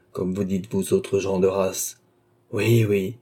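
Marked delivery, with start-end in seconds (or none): 2.5-3.2 a wry, bitter half-smile; bitter amusement. A narrator's reading. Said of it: "avec un sourire amer".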